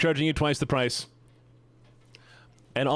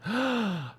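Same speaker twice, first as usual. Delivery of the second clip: surprised gasp; 10 semitones higher, 3 dB quieter than usual. Real, not acted. Sees DMs.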